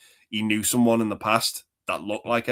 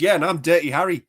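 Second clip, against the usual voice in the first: higher, mocking sarcastic quote; sarcastic cop voice; 7 semitones higher, 5 dB louder than usual. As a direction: scoff like a cop. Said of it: "And he says".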